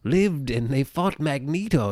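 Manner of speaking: high, slow wide-eyed storyteller; slow and awed, like revealing scripture; earnest believer voice. Retold or imitated. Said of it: "and being like".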